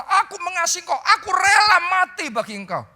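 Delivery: high, loud vow of loyalty; bold declaration. Retold or imitated. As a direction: proclaim it proudly, almost shouting. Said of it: "Petrus berkata".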